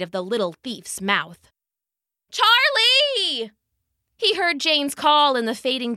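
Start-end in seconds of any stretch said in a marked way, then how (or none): 2.3-3.5 calling out. Acted. Owner calls lost dog.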